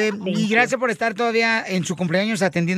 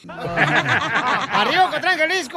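cheering shout; 3 semitones higher, slower than usual. Real, not acted. Cheering hometown.

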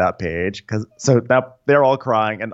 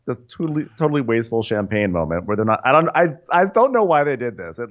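ordinary talk, nothing marked

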